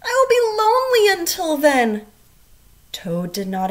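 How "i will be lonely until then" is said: high, loud, pleading; high lonely Frog. A narrator's reading. Frog telling sleepy Toad he'll be lonely, high. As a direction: make Frog sound sad and lonely. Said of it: "said Frog".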